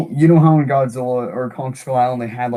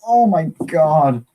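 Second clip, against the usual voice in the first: awed; 4 semitones higher, 4 dB louder, much slower than usual. Retold or imitated. Awed reaction to cave painting.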